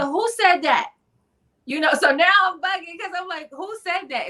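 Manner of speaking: high, alarmed question; a jolt of fright at a voice from nowhere; startled. Retold or imitated. Hearing a voice inside her head, high startled question.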